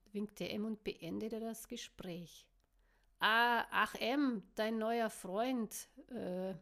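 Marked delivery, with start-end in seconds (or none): 3.2-6.6 slow, loud, awkward hesitation; slow, clumsy opener, unsure how to ask; awkward start. A narrator's reading. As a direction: stumble into it, embarrassed. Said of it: "fing Coop verklemmt an".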